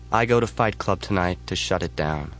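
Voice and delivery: plain reading voice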